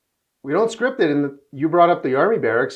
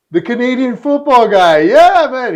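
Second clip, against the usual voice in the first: much higher and louder, delighted recognition; excited mimic of Cuba; 11 semitones higher, 11 dB louder, slower than usual. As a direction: shout it out like a tipsy star who finally remembers.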